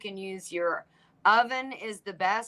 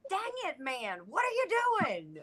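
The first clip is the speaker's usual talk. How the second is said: frustrated quote; 9 semitones higher, 5 dB quieter than usual. Retold or imitated. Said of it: "I'm like".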